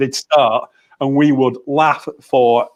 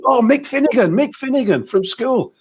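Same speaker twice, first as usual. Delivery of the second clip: surprised recognition; 9 semitones higher than usual. Retold or imitated. Old schoolmate voiced recognizing him.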